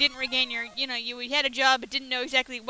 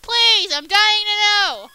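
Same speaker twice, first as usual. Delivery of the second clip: mock pleading; 6 semitones higher, 8 dB louder, slower than usual. Begging the owl for answers.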